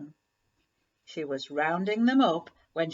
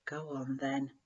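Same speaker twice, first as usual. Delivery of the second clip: much softer, quiet go-ahead to the dog; soft whisper; 5 semitones lower, 8 dB quieter than usual. Acted. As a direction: hush it gently, just for Floss. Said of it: "whispered the farmer to Floss".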